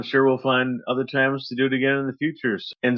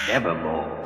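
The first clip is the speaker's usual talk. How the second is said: low, dark raven voice; raven's croak; 8 semitones lower, 3 dB quieter than usual. Acted. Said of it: "Quoth the raven".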